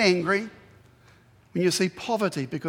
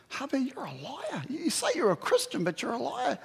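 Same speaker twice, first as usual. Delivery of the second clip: wife scolding; 6 semitones higher, 5 dB quieter than usual. Retold or imitated.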